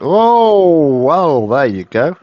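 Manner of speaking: high, loud, slow surprise; a big, drawn-out oh at the roll; surprised. Real, not acted.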